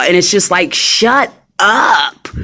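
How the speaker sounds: ordinary talk, nothing marked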